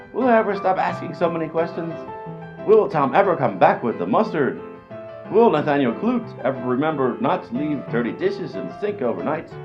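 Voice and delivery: ordinary talk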